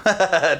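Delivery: loud, high burst of laughter; real laugh. Real, not acted. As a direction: laugh out loud at your own dark joke. Laughs at own trauma joke.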